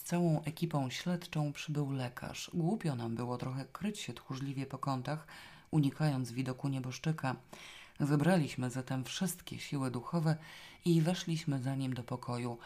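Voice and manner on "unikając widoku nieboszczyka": ordinary delivery, nothing marked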